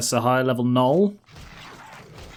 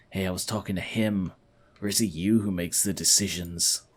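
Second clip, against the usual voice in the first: low, soft and dismissive; sly, scheming partner; 6 semitones lower, 5 dB quieter than usual. Acted. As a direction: brush off the interrupter in a low voice. Galor answers the paladin.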